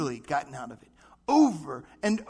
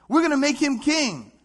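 high and loud, excited; cheering crowd shout; 7 semitones higher, 7 dB louder than usual. Retold or imitated.

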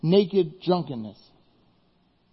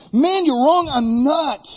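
angry denial; 7 semitones higher, 5 dB louder, slower than usual. Acted. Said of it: "denying it".

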